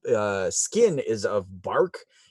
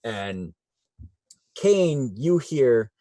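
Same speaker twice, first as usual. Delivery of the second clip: usual speaking voice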